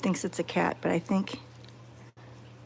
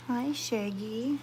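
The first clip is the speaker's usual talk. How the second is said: soft greeting; 2 semitones higher, 8 dB quieter, slower than usual. Real, not acted. Gentle greeting to the dog.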